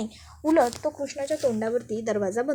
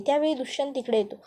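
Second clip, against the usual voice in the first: ordinary delivery, nothing marked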